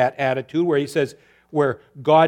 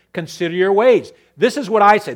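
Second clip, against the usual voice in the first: loud, higher stern command; 4 semitones higher, 8 dB louder than usual. Acted. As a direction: command them to stop. God's command to the people.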